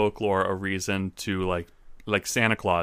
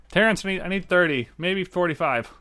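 calling out; 9 semitones higher than usual. Acted. Character calls out to Terrence.

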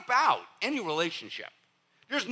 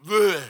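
loud, higher spat-out nonsense noise; blurted bleh; 4 semitones higher, 9 dB louder than usual. Acted. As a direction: blurt it like words spilling out. Imitates blurting out dumb things.

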